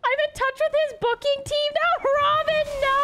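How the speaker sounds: high, loud panicked squeal; panicked shriek. Real, not acted. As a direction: shriek in mock horror. Reacting to donation, panicked.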